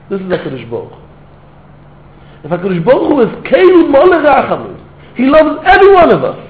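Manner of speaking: high, loud, impassioned sermon build; fiery preaching. Acted. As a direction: pour it out, near tears, preaching at full force. Fervent preaching climax, high and loud.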